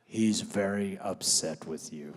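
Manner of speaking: low, solemn medium voice; mock spooky medium. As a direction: lightly intone it like a psychic in a trance. Spiritualist relays ghost's anger.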